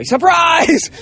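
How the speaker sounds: sudden high, loud shout of surprise. Retold or imitated. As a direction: burst out like a party jumping out. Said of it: "they all jump out and go".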